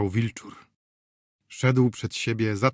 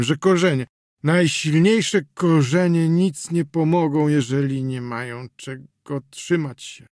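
put-on slurring drunk; 4 semitones higher, 4 dB louder than usual. A narrator's reading. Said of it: "Język mu się plątał coraz bardziej".

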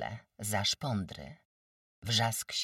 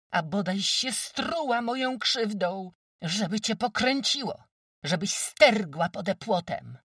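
angry shout; 11 semitones higher, 8 dB louder than usual. Acted. Village women's quarrel.

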